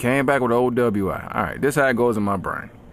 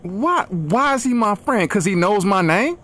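indignant Denzel; 7 semitones higher than usual. Retold or imitated. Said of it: "And I'm like".

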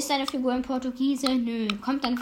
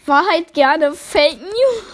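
slow, laughing, pitched up; cracking up mid-sentence; 6 semitones higher, 8 dB louder, slower than usual. Real, not acted. Cracks up over own joke.

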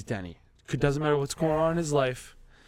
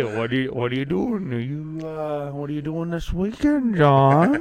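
imitating a nosy workmate. Old workmate grilling John every day, mock nosy. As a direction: drag out the prying questions, then pounce. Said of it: "Or every day".

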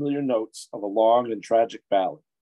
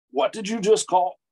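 higher, sharp demand; menacing challenge; 6 semitones higher than usual. A narrator's reading. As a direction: challenge him with a threatening step. Orc threatens Mouse after insult.

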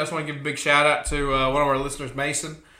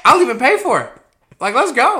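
loud, eager, upbeat; excited offer; 10 semitones higher, 11 dB louder than usual. Retold or imitated. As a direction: push it with enthusiasm. Enthusiastic mock offer.

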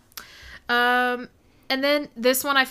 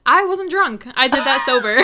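laughing protest; 4 semitones higher, 9 dB louder than usual. Real, not acted. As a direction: deny it through laughter.

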